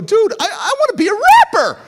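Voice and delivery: high, loud, laughing announcement; excited outburst. Retold or imitated. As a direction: burst out with a brand-new dream. Sudden dream announced to friend.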